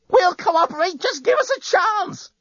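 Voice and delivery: very high, strained cry; desperate plea. Acted. Woman waving at attacking spaceships, desperate. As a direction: beg frantically, voice pitched right up. Lucy pleads with attacking fleet.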